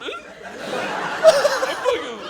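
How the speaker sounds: squeaking through laughter; high-pitched laughing. Real, not acted. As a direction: try to tell it while cracking up. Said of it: "his voice gets all high-pitched".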